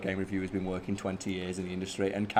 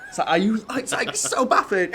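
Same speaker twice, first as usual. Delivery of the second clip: laughing disbelief; 11 semitones higher, 3 dB louder than usual. Real, not acted. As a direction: laugh it out, baffled.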